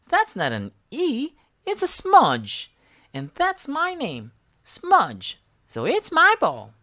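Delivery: put-on a child guarding her toy; stubborn little girl. A narrator's reading. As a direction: claim it like a bossy kid. Girl Smudge claims ball.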